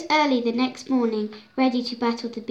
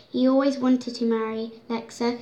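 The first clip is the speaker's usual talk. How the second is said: ordinary delivery, nothing marked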